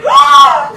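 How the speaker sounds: short high loud mock scream; retold scream. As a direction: shriek it as the roommate did. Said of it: "She was like".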